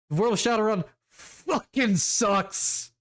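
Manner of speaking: drawn-out, high-pitched exasperation; exasperated rant. Real, not acted. Player venting about World of Shadowrun, exasperated. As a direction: stretch the words out in disgust.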